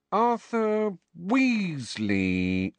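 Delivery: high, very slow clerk voice; slow drawn-out. Acted. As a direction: read the name out slowly. Witch reading slowly.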